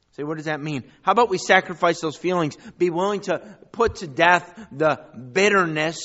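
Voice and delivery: loud, driving preacher's build; sermon climax, loud; full-force preaching. Acted. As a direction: preach it out to the congregation. Exhorting congregation to surrender bitterness.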